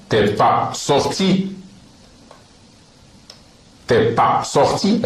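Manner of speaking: T'es pas sorti stretched very slowly with a rising end; very slow demo. Shown as a demonstration. Teacher slowly modelling T'es pas sorti as a question. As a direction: pull every syllable out slowly.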